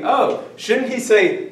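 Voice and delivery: high, mock-puzzled student question; imitating doubting students. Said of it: "you're thinking".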